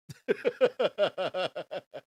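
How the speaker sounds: loud burst of laughter; loud amused laugh. Real, not acted. Laughing at Trump booed.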